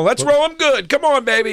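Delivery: high, eager gambler's cheer; rallying the table. Retold or imitated. Gambler tries to get the energy up, excited cheer. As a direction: egg on the dice and the players. Acting out himself hyping the table.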